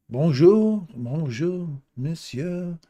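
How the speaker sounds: bright, high Canadian French bonjour, loud at first; French greeting. Pretend. Greeting a viewer in Canadian French, high and bright. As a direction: greet him in French, rising and cheerful. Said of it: "apologize for my French".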